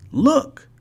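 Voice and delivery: high, excited storybook voice; a bright pop of discovery; lightly voiced character spotting a hotel; Fred's voice. A narrator's reading. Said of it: "said Fred".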